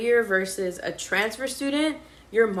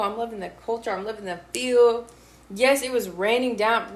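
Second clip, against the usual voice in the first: usual speaking voice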